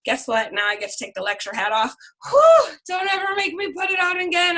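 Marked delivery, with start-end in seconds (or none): 2.3-2.8 relieved whew. Real, not acted. Relief after dropping lecture hat. 2.9-4.6 mock exasperated shout. Joking after lecture.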